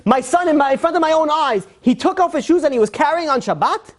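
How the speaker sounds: a strict father scandalized by his son; high, incredulous outrage; outraged father. Retold or imitated.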